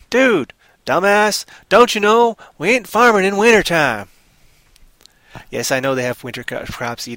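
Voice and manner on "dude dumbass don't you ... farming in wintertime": higher, louder scolding farmer; a fed-up farmer snapping at a drifter; gruff farmer. Acted.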